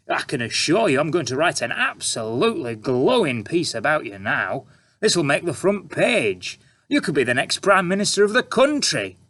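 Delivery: oozing fake praise; sarcastic reporter. A narrator's reading. Reporter flatters Mother.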